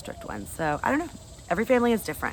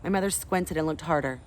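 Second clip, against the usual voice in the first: ordinary delivery, nothing marked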